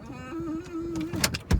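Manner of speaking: cow moo. Retold or imitated. Cow bellowing in truck.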